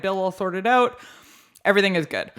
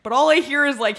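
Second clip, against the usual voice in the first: usual speaking voice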